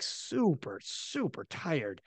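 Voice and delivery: slow, soft and drained on "super tired"; a sleepy imitation of a worn-out lifter; mock tired drawl. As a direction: let it sag like you're wiped out. Acting out Ray's exhaustion.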